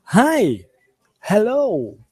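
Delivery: "hi" and "hello" each in a high, swooping tone; expressive intonation. Shown as a demonstration. Teacher modelling greetings "hi" and "hello", with expressive intonation. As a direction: swing the pitch high on "hi" and "hello". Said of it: "colocando entonação".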